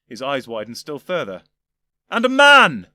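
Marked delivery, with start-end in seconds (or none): 2.1-2.9 near-yell, much higher and louder; almost yelling in disbelief; shocked near-shout. Acted. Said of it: "almost yelling".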